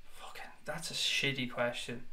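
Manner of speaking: whispered under the breath, disgusted. Real, not acted. Streamer whispering disgust at a reporter's question. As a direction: hiss it to yourself in disgust.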